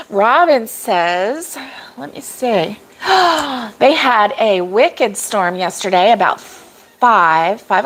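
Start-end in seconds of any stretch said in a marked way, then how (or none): 3.0-3.7 sharp high intake of breath; startled gasp. Real, not acted. Speaker reading a viewer's storm news, startled gasp. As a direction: gasp in dismay.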